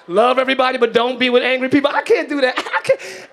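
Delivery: usual speaking voice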